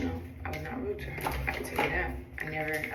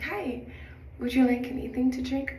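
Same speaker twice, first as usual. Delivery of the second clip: higher-pitched flight attendant offer; polite attendant greeting; 6 semitones higher, 3 dB louder than usual. Retold or imitated. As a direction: re-enact a chirpy service greeting. Acts out her polite cabin greeting.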